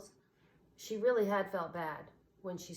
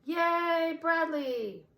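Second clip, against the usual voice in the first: much higher, louder cheer; 10 semitones higher, 7 dB louder, much slower than usual. A narrator's reading. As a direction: cheer for the hero. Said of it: "They all cheered".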